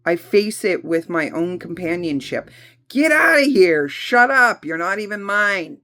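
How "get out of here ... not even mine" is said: defiant shout. Shown as a demonstration. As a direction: drive the gremlin out with a shout. Shouting down the inner gremlins.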